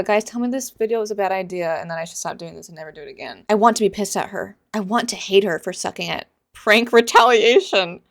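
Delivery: ordinary delivery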